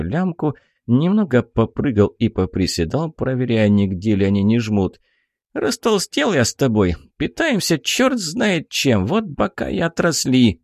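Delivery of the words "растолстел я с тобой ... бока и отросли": high, grumbling pixie voice; a tiny fairy moaning about weight. Acted. Pixie complains.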